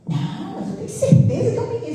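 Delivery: high, fast, stubborn protest; insistent husband imitated. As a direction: mimic the husband insisting it's a boy. Marido teimoso imitado. (marido imitado insistindo, voz aguda e rápida)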